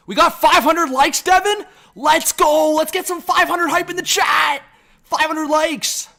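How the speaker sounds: high, loud streamer hype; excited hype. Real, not acted. Hyping a likes milestone.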